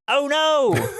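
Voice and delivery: high, drawn-out fake dread; mock alarm. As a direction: wail it like a hammed-up plot twist. Mock dread at Scott waking up.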